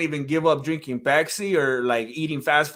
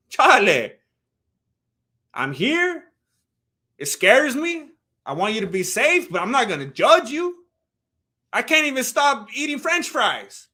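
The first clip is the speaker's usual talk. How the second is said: raised, incredulous retold protest; indignant reassurance; 5 semitones higher, 5 dB louder than usual. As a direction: push back, pitched up, refusing to judge. Retelling his indignant reassurance to friend.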